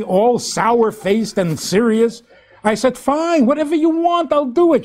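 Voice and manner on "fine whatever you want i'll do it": high-pitched mock exasperation; acting out a fed-up husband, high; exasperated husband. Husband in retold marital quarrel.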